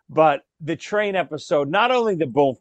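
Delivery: ordinary talk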